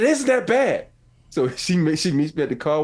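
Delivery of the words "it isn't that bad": high, loud mocking wife voice; mimicking wife. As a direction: play her dismissive in a high voice. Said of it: "She said".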